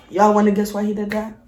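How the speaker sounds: normal talking voice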